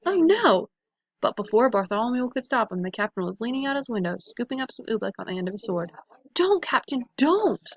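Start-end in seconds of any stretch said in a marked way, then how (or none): 0.1-0.7 high loud cry of alarm. A narrator's reading. Horrified cry. 6.3-7.7 high pleading shout; urgent shout. A narrator's reading. Trying to stop the captain.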